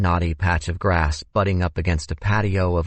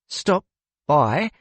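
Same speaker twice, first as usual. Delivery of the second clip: hesitant, higher-pitched query; frowning hesitation; 9 semitones higher than usual. A narrator's reading. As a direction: echo it haltingly, with a frown in the voice. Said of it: "the frown in Xander's voice, the sudden hesitation".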